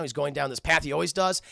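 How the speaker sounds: ordinary delivery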